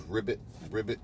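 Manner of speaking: lightly imitated frog ribbit; soft, offhand croak of an unimpressed frog; frog croak imitation. Showing how the cartoon frog clams up, soft mock croak. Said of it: "the frog just goes".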